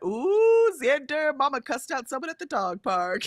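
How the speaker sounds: put-on a kid gleefully telling on mom; teasing tattle. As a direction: daughter tattling to her brother, excited. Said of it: "Jenna was like".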